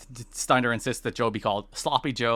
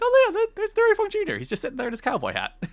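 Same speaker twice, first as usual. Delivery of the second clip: high, fake-surprised aside; a high, exaggerated voice like you only just noticed him; mock surprise; faster than usual. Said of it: "it's like".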